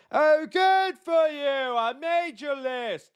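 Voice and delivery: high, loud, slow mock gushing voice. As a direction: puff up like a flattered star.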